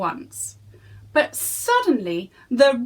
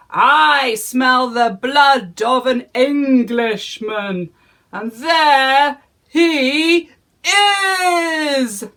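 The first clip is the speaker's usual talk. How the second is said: loud, higher booming roar; roaring giant; 5 semitones higher, 7 dB louder, slower than usual. Acted. Giant chasing Jack, roaring loud. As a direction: roar each word after Jack. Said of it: "roared the giant".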